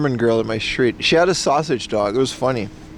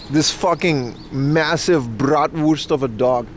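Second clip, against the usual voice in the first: normal talking voice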